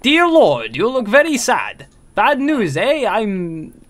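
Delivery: sing-song mock Spanish accent; put-on Spanish accent. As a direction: play him with a hammed-up Spanish accent. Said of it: "Let me do that in the accent".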